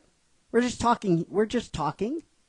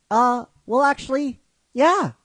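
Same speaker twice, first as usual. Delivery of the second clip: much higher, louder acted reply; sheepish admission; 9 semitones higher, 4 dB louder than usual. Brock answers Misty's question, sheepish and high. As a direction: admit it in a raised, higher voice.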